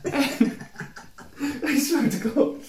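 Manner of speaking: breathy giggling through words; laughing. Real, not acted. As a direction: laugh while saying it.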